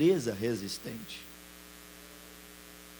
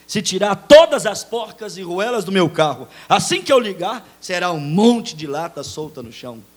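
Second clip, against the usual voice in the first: louder forceful preaching voice; 4 semitones higher, 11 dB louder than usual. Real, not acted. Pastor exhorting the church, loud and forceful.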